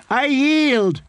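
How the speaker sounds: high, pleading cry. A narrator's reading. Fool crawling away from the swordswoman, crying out. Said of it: "the fool cried".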